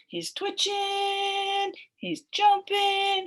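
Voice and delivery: high, slow, sing-song excitement; excited. A narrator's reading. Teacher describing the excited monkey puppet, high and slow. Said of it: "he's excited".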